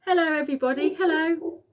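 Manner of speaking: put-on boy hailing the crowd below; calling out happily. A narrator's reading. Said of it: "laughing and waving and calling out".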